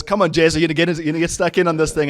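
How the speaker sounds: loud, high, rapid-fire imitation; loud mock goading. Coach goading employee mid role-play, loud imitation. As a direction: goad them forcefully and fast.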